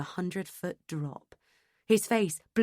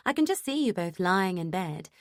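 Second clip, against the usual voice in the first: plain reading voice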